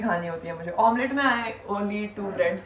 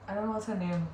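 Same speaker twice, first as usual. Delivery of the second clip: usual speaking voice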